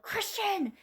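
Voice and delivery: high calling out of son's name; yelled name. Retold or imitated. Mom waking up from a nightmare, yelling her son's name. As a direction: yell his name across the house.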